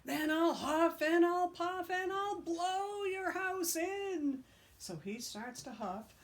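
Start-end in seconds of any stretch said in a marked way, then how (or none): 0.1-4.4 slow, high, loud menacing wolf; wolf threatening the stick house; wolf threat. Acted. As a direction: draw out the threat.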